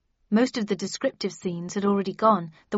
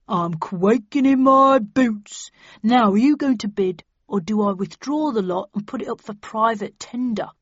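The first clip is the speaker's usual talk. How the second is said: sarcastic; 6 dB louder than usual. A narrator's reading. Dealer mocking her threat.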